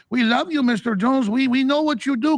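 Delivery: high, lightly put-on Mexican accent; mock Mexican accent. Vineyard workers praising Alex, lightly put-on Mexican accent. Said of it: "They could barely speak English".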